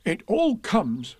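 high, slow, cross complaint; cross Pooh. A narrator's reading. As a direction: grumble crossly, stretching the words. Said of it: "said Pooh crossly".

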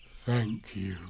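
soft, gruff bear thanks; low-key bear growl. A narrator's reading. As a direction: growl it quietly, overcome. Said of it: "growled Pooh".

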